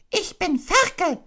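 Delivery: very high, squeaky insistent cry; loud Piglet. Acted. Piglet insisting to Kanga he isn't Roo, loudly. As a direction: protest loudly as a tiny pig. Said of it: "sagte Ferkel laut".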